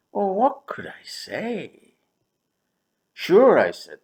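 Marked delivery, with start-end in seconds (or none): 3.1-3.6 high, loud, smiling agreement; sugary yes to the boss; sweet sure. Retold or imitated. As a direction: say it sweetly through a smile. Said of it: "smiling sweetly at my boss".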